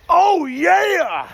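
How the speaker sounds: very high, loud, stretched oh yeah; hyped oh yeah. Shown as a demonstration. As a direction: celebrate with your partner. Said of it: "and say".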